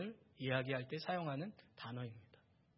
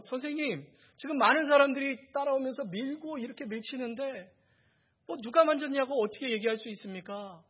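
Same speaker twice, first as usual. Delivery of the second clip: raised-pitch, exasperated protest; higher and pleading in disbelief; incredulous disciples; 6 semitones higher, 4 dB louder than usual. Acted. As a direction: object as if the question were absurd. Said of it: "제자들은 너무 어처구니가 없는 겁니다".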